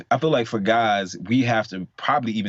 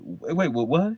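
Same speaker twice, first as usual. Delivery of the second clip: usual speaking voice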